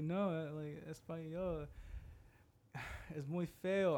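put-on high, drawn-out kid complaining; mock childish disgust; childish whine. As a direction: whine it like a little kid refusing. Child self refusing Spanish, retold.

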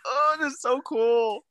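high, slow wide-eyed wonder; amazed. Retold or imitated. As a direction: marvel at it like a kid. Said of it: "I was like".

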